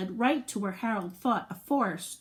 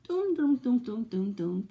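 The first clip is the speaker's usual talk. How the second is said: mock footsteps chanted, stepping down in pitch; march the words out like little steps; walking sound. Reading to kids, Harold walking, chanted footstep sounds.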